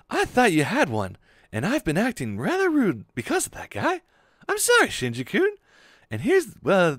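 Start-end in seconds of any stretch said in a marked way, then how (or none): 4.5-5.5 high apology. Acted. Character apologizing.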